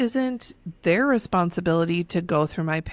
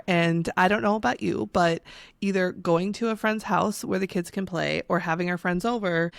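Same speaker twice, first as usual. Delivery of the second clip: usual speaking voice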